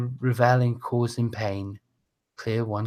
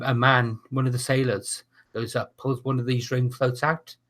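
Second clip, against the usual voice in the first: ordinary talk, nothing marked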